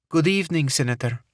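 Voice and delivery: put-on smooth, high Middle Eastern accent; a woman's smooth voice, Middle Eastern accent. A narrator's reading. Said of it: "a woman's smooth voice, tinged with a Middle Eastern accent".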